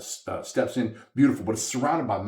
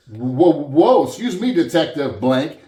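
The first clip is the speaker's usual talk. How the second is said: loud, higher-pitched mock protest; mock taken aback; 4 semitones higher, 7 dB louder, slower than usual. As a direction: pull him up short with exaggerated shock. Retelling his shocked reply to detective.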